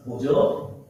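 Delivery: usual speaking voice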